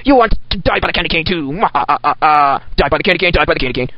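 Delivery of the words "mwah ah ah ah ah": evil cackle. Acted. Evil Santa gloating laugh.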